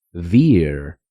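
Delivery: beer said very slowly as bir; slow model of beer; English pronunciation. Shown as a demonstration. Teacher models the word for learners, very slowly. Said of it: "se pronuncia".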